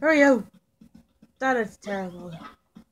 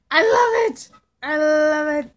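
delighted squeal; 4 semitones higher, 5 dB louder than usual. Real, not acted. Delighted by janky game spot.

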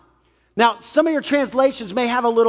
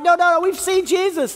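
much higher, breathless urgency; excited disciples; 9 semitones higher than usual. Retold or imitated. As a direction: insist eagerly they saw him.

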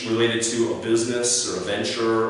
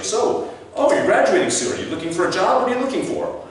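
very high-pitched nosy small talk; chirpy questioner; 11 semitones higher than usual. Acted. Stranger quizzing a new graduate, high and eager.

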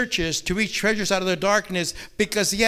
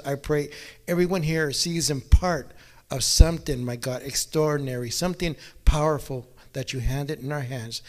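prayer; 5 semitones lower, 3 dB quieter than usual. Acted. Opening prayer before the message.

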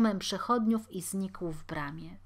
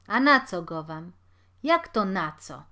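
loud, sharp comeback; heated retort; 9 dB louder than usual. Acted. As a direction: snap back at her.

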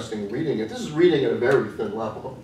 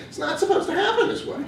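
put-on Midwestern American accent, much higher pitch; a lightly voiced Midwestern American girl, pitched up; Midwestern voice breaking through. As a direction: let a plain Midwestern American accent crack through the polish. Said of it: "This very American Midwestern voice".

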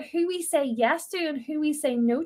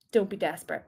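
usual speaking voice

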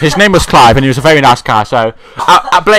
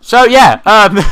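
impression of Blake; 6 semitones higher, 6 dB louder, slower than usual. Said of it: "I learned how to do impressions".

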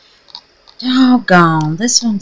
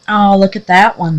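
normal talking voice

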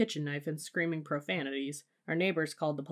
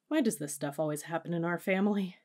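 usual speaking voice

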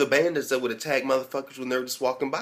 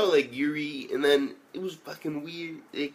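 normal talking voice

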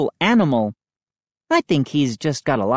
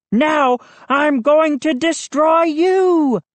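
high, slow, drawn-out threat; menacing shout; 10 semitones higher, 3 dB louder, slower than usual. A narrator's reading. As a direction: threaten like a play monster. Zombie-style threat before a play attack.

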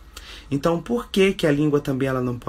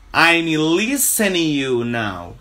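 slow and deliberate, stretching listening; slow teaching model; 2 semitones higher, 3 dB louder, slower than usual. Shown as a demonstration. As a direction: spell it out with your voice for learners. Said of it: "Eu estou ouvindo você agora".

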